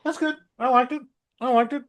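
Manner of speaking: squeaky-high mock approval; high-pitched insistence. Reviewer defending Fantastic Four, high mock-positive voice. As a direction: jump way up in pitch, repeat it unconvincingly.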